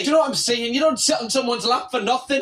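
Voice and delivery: ordinary talk, nothing marked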